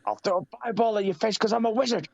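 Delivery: high, quick mock Jericho voice; squeaky imitation of Jericho's line; mocking high-pitched wrestler quote; Jericho impression. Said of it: "all he said is".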